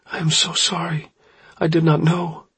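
high, tearful apology; Oryx crying. A narrator's reading. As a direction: plead as if close to tears. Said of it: "Oryx was crying".

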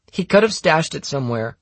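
high-pitched accusation; accusing girl. Acted. Anna accuses the narrator of hiding money, accusing.

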